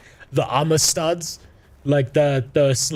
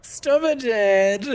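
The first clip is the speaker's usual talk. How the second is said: put-on much higher, bubbly Valley Girl; 9 semitones higher, much slower than usual. Shown as a demonstration. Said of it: "a Valley Girl voice for every single one".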